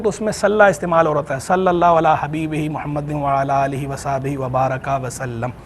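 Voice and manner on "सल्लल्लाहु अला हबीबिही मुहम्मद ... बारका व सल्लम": low, even recital of Arabic prayer; Durood Khizri recitation. Shown as a demonstration. Scholar reciting his own durood, low and even. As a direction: recite smoothly on a lowered pitch, fading at the end. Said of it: "जो दुरूद खिजरी पढ़ता हूँ".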